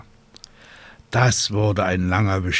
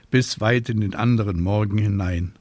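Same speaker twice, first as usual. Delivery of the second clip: plain reading voice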